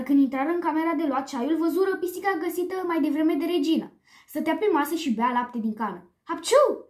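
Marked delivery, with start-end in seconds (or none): 6.2-6.9 loud, high explosive "achoo"; acted sneeze. A narrator's reading. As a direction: burst out with a big spoken sneeze. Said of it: "explodă un nou strănuț". (strănut exploziv)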